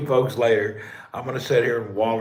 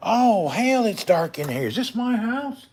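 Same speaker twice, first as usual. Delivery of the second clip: ordinary talk, nothing marked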